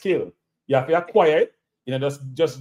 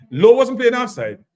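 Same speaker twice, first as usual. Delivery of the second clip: emphatic protest; 7 semitones higher, 7 dB louder than usual. Real, not acted. Heated football argument.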